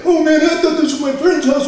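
loud, high imitation of a panicked excuse; pleading burglar. Caught burglar makes excuses to homeowner, mock pleading. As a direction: plead your innocence in a high, put-on voice.

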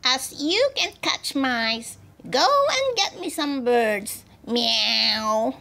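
cross cat, high drawn-out demand; Missus Ginger. A narrator's reading. Mother cat sending the girl for birds, cross cat voice. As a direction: order crossly with a meow. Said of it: "said bad Missus Ginger very crossly".

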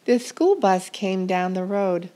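usual speaking voice

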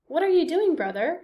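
sudden high call to brother; Coyote calling out. Acted. Coyote voiced again.

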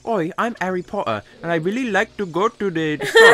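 high, overdone fake British accent; hammed-up British accent going astray; bad British accent. Mocking people who try too hard, bad British accent. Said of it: "do a British accent".

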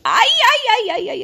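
high, loud ouch cries; loud pained yelps. Real, not acted. Mother crying out as son squeezes her feet, high and loud. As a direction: yelp high in mock pain.

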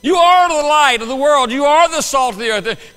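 loud, slow, proclaiming from the pulpit; preaching shout. Real, not acted. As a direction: proclaim it slowly at full voice. Preaching climax, full force.

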